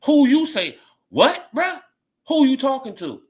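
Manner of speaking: high, loud "who you talking to" challenge; confrontational. Acted. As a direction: square up and demand who he means. Confronting the guy in the room.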